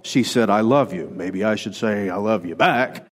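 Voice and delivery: low, soft thinking aloud; processing it. Acted. Man working out how to answer "I love you", processing. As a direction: reason it through in a low voice. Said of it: "And so we process".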